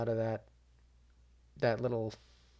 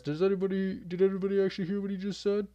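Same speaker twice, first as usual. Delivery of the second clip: higher, fast mocking sneer, imitating the jab; mocking quote; 8 semitones higher, faster than usual. Retelling Bo mocking Craig's Scottish accent, higher and fast. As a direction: mimic the fighter sneering at an accent. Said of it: "makes fun of his accent and says".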